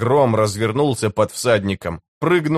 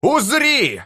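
very high, sustained war cry; рёв; 14 semitones higher than usual. A narrator's reading. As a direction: roar it out high to the heavens. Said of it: "заревел он". (высокий протяжный рёв)

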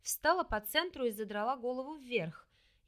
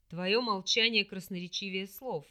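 low, sad disappointed demon voice; 3 semitones lower, 4 dB louder than usual. Acted. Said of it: "с грустью и разочарованием произнёс демон".

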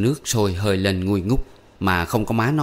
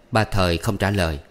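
ordinary delivery, nothing marked

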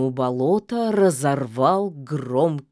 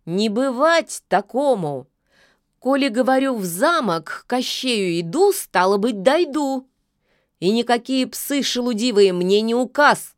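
high, loud defiant retort; 7 semitones higher, 3 dB louder than usual. Acted. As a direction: stand your ground, loud and proud. Witch defiantly refuses the wolf.

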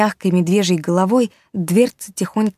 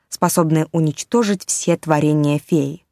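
usual speaking voice

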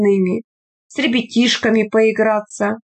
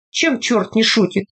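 plain reading voice

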